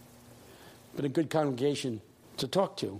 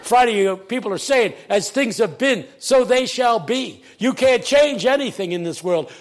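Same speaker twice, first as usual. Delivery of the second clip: loud, raised, driving preaching; preacher taking off; 5 semitones higher, 7 dB louder than usual. Retold or imitated. Pastor to cheering church, preaching at full force. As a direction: imitate a pastor hitting full stride. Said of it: "He took off".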